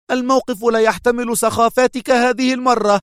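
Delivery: high, loud yell; furious yell; shouting. A narrator's reading. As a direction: shout him down. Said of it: "صاحت به".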